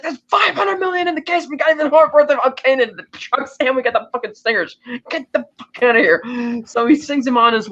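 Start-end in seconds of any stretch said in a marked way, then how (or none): 0.0-6.2 high mock shout, easing down slightly; angry outburst. Drug boss overruling his money man, angry outburst. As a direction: act out a boss yelling an underling out. Boss snaps back at upset underling.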